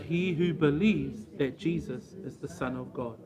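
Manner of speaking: soft, measured Bible reading; scripture reading. Acted. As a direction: read the passage reverently and softly. Reads scripture passage aloud.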